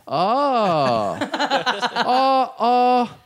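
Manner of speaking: bartender laughing awkwardly on each oh. Retold or imitated. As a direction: imitate the bartender's uneasy laugh. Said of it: "bartenders said to me".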